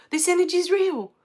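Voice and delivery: this energy is real, now pushed up with emotion; retold overwhelmed awe. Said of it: "I just sat there crying".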